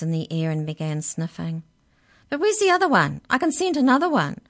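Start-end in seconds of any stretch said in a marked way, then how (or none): 2.3-4.4 high, loud canine character voice; sniffed out a stranger, loud and high; canine leader. Acted.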